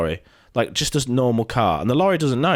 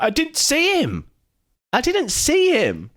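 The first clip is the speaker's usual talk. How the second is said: very high-pitched imitated pleading; mock protest; slower than usual. Lorry driver defending himself to the guy, high mock protest. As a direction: insist it defensively, pitch shooting up.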